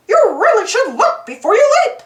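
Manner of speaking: high, bright cartoon-mouse voice, gently teasing; squeaky little hero giving friendly advice; Mickey Mouse voice. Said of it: "Mickey said to the frog".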